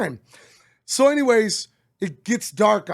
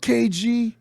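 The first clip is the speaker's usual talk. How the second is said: normal talking voice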